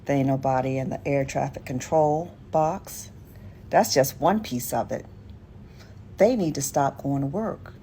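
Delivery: ordinary delivery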